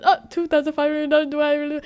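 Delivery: quick, high, amazed; playful disbelief at the bid; mock surprise. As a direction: gasp at the price, fast.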